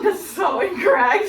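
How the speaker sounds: loud laughing protest. Real, not acted. Sister laughs at airport claim.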